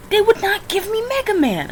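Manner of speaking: high, indignant whine; whining high in indignation; high whiny complaint. Real, not acted. Complaining about results.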